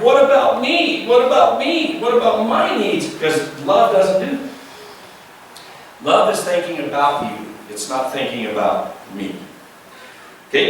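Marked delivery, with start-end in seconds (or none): 0.0-3.1 mock a self-centered whine; pitched-up whine of someone who wants theirs; whiny selfish plea. 7.7-9.3 softer, lower landing of the lesson; hushed sincere conclusion; quiet sincere. Real, not acted.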